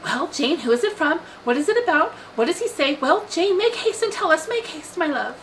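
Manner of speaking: high-pitched, impatient volley of questions; eager calling out. A narrator's reading. Mother pestering her daughter as she reads a note, eagerly calling out. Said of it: "she was eagerly calling out".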